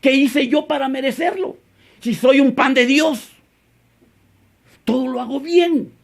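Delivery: high-pitched, self-justifying protest; mock complaint. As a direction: imitate a believer complaining to God. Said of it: "Muchas veces le dices a Dios".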